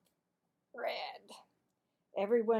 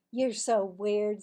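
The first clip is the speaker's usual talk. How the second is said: put-on accusing girl; 10 dB louder, slower than usual. Cassie to Marvin, loud accusing tattle. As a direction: point the finger, triumphant.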